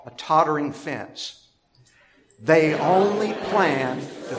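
measured, solemn reading of the psalm; scripture reading. Acted. Reads Psalm 62 aloud.

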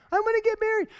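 put-on high, eager kid's announcement. Kid tells parent: high, eager kid's request. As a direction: play the kid begging to marry.

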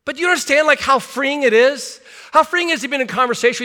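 high, loud impassioned plea; rising preacher's fervour; fervent appeal. Real, not acted. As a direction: lift the congregation, pleading. Preaching climax about freedom.

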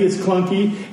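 normal talking voice

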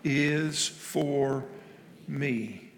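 very slow, word by word. Shown as a demonstration. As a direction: stretch each word apart. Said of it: "Say it slower".

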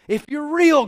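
high, loud retold plea to God; crying out. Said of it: "he cried out and he said".

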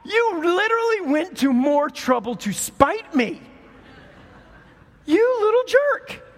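rising, exasperated mock scold at a toddler; hammed-up annoyed parent. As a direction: playfully scold the little jerk. Mother scolding toddler's spite.

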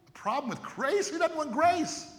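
mock astonishment at the idea; incredulous. Incredulous rhetorical question.

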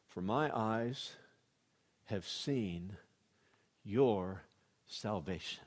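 low, soft and slow, with long pauses; awed, unhurried quiet reading; hushed reverence. Retold or imitated. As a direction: quote it gently, in a hushed low voice. Simeon holds baby, blesses God.

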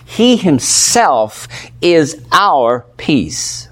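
slow, stressing "himself" in "He himself is our peace"; slow emphatic phrase. Shown as a demonstration. Preacher to congregation, slowly stressing "himself". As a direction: drive "himself" home slowly. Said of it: "this is emphasizing his person".

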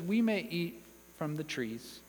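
understated, unemphatic quote; simple acknowledgement. Acted. Preacher voicing Eve minimising the provision, understated. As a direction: let it drop, no weight on any word. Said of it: "Eve simply acknowledges".

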